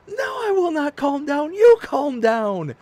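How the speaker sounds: high, loud, frantic comeback; hammed-up hysterical refusal; indignant retort. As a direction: snap back, dragging out the last words. Retold shouted argument with wife.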